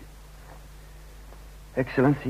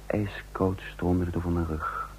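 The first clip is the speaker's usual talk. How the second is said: slow, soft whisper of sudden dread; hushed inner panic; shocked whisper; 7 dB quieter, slower than usual. A narrator's reading. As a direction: breathe it out as a chill runs down the spine. Narrator's inner shock at question.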